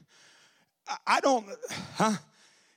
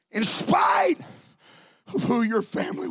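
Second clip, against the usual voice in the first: preaching shout; 5 semitones higher than usual. Acted. Preaching at full force.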